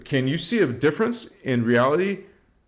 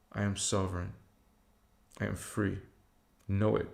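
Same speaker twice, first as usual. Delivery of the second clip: guided affirmation; 3 semitones lower than usual. Acted. A guide leading viewers in affirmations, growing hushed.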